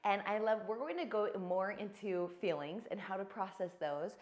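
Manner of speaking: ordinary talk, nothing marked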